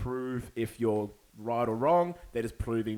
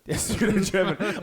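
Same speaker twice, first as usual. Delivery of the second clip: laughing; 9 semitones higher, 7 dB louder than usual. Real, not acted. Joking about fake German.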